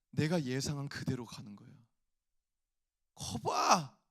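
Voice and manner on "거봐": smug told-you-so. Retold or imitated. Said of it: "이런 마음이 내 속에서 막".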